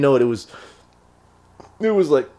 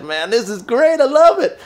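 delighted Neil; 8 semitones higher than usual. Retold or imitated. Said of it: "And he said".

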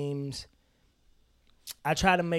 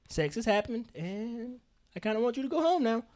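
ordinary delivery

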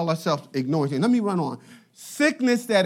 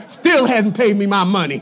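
loud mock grumble; hammed-up resentful complaint; secret resentment; 11 dB louder than usual. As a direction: blurt the grudge loudly, playing it for laughs. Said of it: "secretly feeling resentment towards people".

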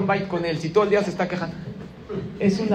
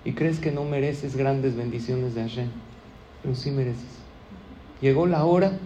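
normal talking voice

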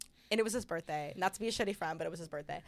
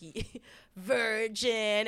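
laughing tease; 4 semitones higher, 6 dB louder, much slower than usual. Retold or imitated. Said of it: "the guy just started laughing".

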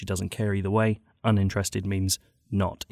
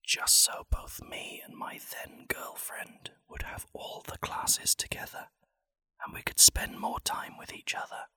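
ASMR whisper; 5 semitones higher, 5 dB quieter than usual. Pretend. Comedian reading a sad story like it's ASMR, whispering. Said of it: "like it's A S M R".

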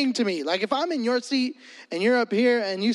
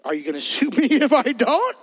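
laughing question; 3 dB louder than usual. Retold or imitated. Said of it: "I'm like".

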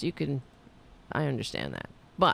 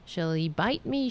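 normal talking voice

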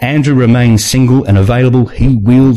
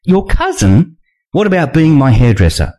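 Nina voice; 7 semitones higher than usual. A narrator's reading.

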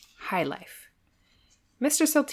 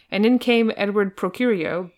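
ordinary talk, nothing marked

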